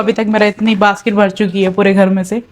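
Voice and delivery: ordinary delivery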